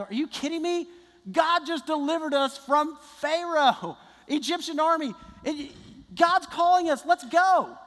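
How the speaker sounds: high, rising to a loud call; pitched high and building to a loud shout; excited rallying cry. Acted. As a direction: fire the people up to go. Joshua and Caleb rally the people.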